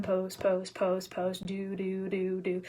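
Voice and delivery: low, soft mechanical chant of the routine; quietly monotone, going through the motions; mock robotic repetition. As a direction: drone it like an endless chore. Mimics monotonous posting routine.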